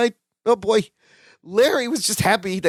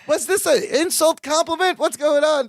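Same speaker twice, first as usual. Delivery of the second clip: higher-pitched, puzzled questioning; mildly acted bewilderment, pitched up; confused; 7 semitones higher than usual. Larry puzzles over a backhanded compliment, confused. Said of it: "Larry has a confused look".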